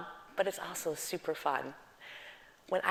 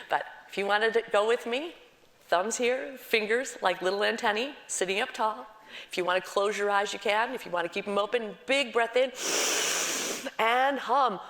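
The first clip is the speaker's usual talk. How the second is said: higher, louder, upbeat exercise cues; guided breathing cue; 5 semitones higher, 5 dB louder than usual. Acted. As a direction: lead the group into the breath. Guides the class through exercise.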